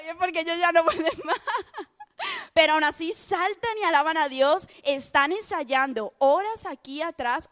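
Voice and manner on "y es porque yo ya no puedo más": laughing through it. Real, not acted. Laughs while admitting exhaustion.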